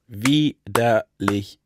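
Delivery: "Widerlich" very slowly as "Wi-der-lich"; syllable by syllable. Shown as a demonstration. Partner counting syllables of podcast name, chopped "Wi-der-lich". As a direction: break "Widerlich" into separate beats. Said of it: "wie viel Silben hat der Name".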